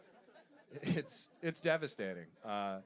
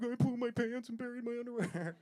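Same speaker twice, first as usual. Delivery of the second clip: put-on much higher, small childish confession; little kid voice; 12 semitones higher than usual. Boy caught by dad at night, high small confession.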